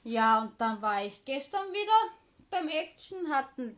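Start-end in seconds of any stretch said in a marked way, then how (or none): none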